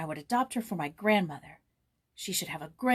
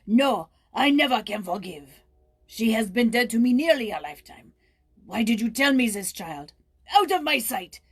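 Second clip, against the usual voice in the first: loud, violent outburst; furious cry; 9 dB louder than usual. Acted. Old man ordering Joyce out, furious loud shouting. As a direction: drive her out in fury. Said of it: "he cried, turning with a violence".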